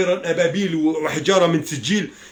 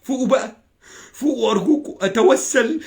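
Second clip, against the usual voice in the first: ordinary delivery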